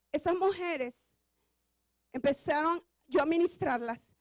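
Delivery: ordinary delivery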